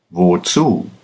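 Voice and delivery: usual speaking voice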